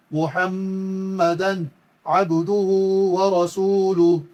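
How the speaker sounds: slow chanted kalima, each word drawn out; slow Quranic recitation. Shown as a demonstration. Teacher recites the whole kalima for students, slow chant. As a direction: recite it like in the mosque, unhurried.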